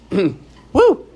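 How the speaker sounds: throat clears, then a high cracked woo; the voice jumps up and breaks on woo; cracked high whoop after a throat clear; voice cracking. Real, not acted. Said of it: "there's the voice".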